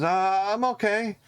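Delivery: put-on high, hesitant young apprentice; a timid kid answering a stranger; nervous apprentice. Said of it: "stops and looks and goes".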